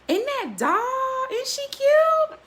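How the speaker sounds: high, slow, drawn-out squeal of delight; gushing. Real, not acted. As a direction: swoon over how cute it is. Gushing over the cookware.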